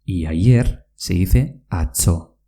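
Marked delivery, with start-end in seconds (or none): 1.7-2.4 "atzo" with a ts, as in cats; tz sound. Shown as a demonstration. Teacher gives the Basque word for yesterday, tz as ts. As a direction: say "atzo" with tz as a sharp ts. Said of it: "con una T Z".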